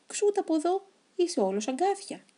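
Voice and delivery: high, sharp brush-off; shooing him away. Acted. Townsfolk chasing off the prickly hedgehog, scornful.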